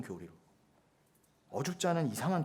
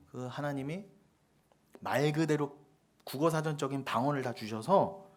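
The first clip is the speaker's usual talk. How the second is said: normal talking voice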